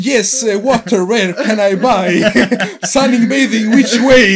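high, halting broken English, slow and groping; mocking her own dumbed-down English; broken tourist English. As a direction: play a clueless tourist hunting for words. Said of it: "jag bara".

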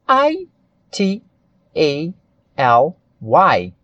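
slow English letter names, one at a time; letter-by-letter spelling. Shown as a demonstration. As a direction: spell it out letter by letter, pausing between each.

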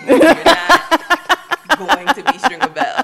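laughing through a slow mock scolding; a mom laughing as she scolds her son. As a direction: tease him while laughing. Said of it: "I'm like".

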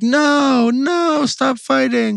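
high-pitched mock woman begging him to stop; imitating girlfriend. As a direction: ham up her pleading squeal. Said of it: "she's like".

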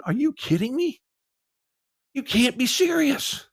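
high, strained outrage, slowing down; can't believe it, pushing up high; incredulous. Real, not acted. Fan ranting at the front office, outraged and incredulous. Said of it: "frustrated and infuriated".

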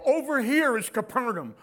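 loud pointing out sites; tour guide pointing out. Retold or imitated. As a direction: guide calling out places. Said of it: "and they go".

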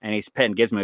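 ordinary delivery

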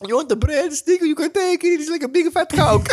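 high, loud mock Italian waiter; Italian accent. Playing an Italian waiter offering dishes, mock Italian accent. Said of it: "that was an Italian accent".

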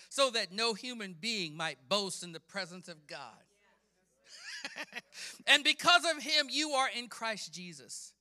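loud proclamation, easing at phrase ends; fervent scripture preaching. Acted. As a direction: declaim the verse with conviction.

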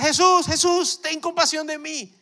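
high, loud cry for Jesus; desperate shout. Acted. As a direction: scream for Jesus's mercy. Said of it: "Comienzan a gritar".

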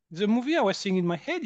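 normal talking voice

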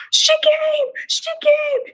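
put-on high, squealing little boy's joy; excited child. Mom imitating her son finding fairy dust, high excited squeal. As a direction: squeal it like a thrilled kid. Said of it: "And he was like".

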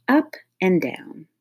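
plain reading voice

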